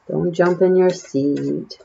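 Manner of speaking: usual speaking voice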